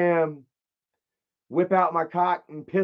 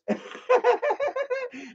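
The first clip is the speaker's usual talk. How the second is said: high burst of laughter; 4 dB quieter than usual. Real, not acted. Laughs at usher's shout.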